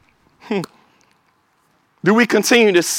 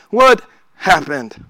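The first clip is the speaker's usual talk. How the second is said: low inward echo; 4 semitones lower, slower than usual. Acted. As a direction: lower and slow, as if asking yourself. Repeating the question, second take.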